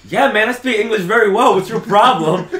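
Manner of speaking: indignant retort. Retold or imitated. Teller retorts to the cashier.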